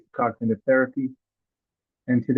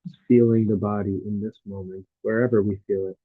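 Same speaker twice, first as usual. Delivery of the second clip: guided meditation; 2 semitones lower than usual. Real, not acted. Teacher guiding listeners through a body scan, calm meditative delivery.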